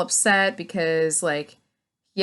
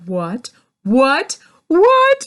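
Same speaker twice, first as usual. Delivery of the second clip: rising shocked what, each louder and higher; disbelieving what; 7 semitones higher, 8 dB louder, much slower than usual. Retold or imitated. Reacting to the show's twists, escalating shocked what. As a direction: climb from a mutter to a shriek of disbelief. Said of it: "And I'm just like".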